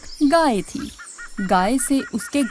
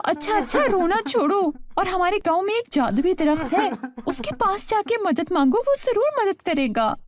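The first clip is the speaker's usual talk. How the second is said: higher, soothing wife telling husband to stop crying; wife consoling her husband, higher voice; wife's voice; 7 semitones higher than usual. Acted. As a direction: pitch up into the wife and calm him down. Narrator voices wife Saima.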